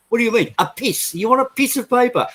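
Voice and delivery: high mock French accent, teasing. Bartender teasing Thierry in a high mock French accent. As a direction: keep mimicking the French accent. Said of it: "taking the piss out of his accent".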